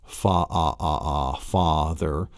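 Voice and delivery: "father" drawn out as "fa, ah, ah, ah"; sounding out father. Shown as a demonstration. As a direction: isolate the open "ah" in "father", then say it whole. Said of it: "over the A in father".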